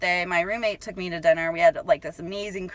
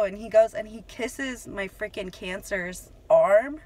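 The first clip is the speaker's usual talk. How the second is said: usual speaking voice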